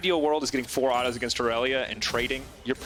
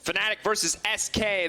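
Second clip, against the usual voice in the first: announcer call; 7 semitones higher than usual. Real, not acted. Announcing the matchup.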